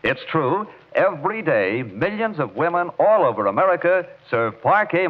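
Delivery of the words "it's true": usual speaking voice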